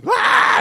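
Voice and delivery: sudden high shrill cry; imitated baby wail. Mimicking the baby starting to cry again, a high wail. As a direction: mimic the baby's scream starting.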